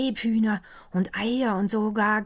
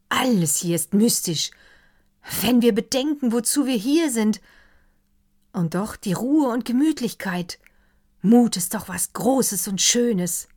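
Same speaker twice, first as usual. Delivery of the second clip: a lightly voiced doctor, higher and louder, carried away; enthusiastic doctor; 6 semitones higher, 8 dB louder than usual. Doctor raving to the counts over dinner, higher and louder. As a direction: let the doctor gush over the dark forest.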